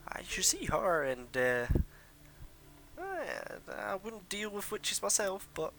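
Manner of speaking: put-on high, squeaky old woman; gossiping old woman. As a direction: gossip slyly about the witch, high-pitched. Old woman gossiping about witches.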